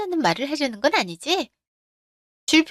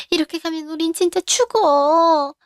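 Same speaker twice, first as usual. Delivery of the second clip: desperate pleading; 4 semitones higher, 3 dB louder than usual. Acted. Mother begging daughter-in-law to stay.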